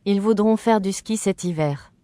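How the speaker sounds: ordinary talk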